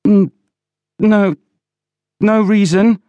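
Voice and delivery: high, halting, scared denial; frightened stammer. A narrator's reading. Villiers squeezes Oland's face, frightened stammer.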